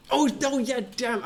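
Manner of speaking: normal talking voice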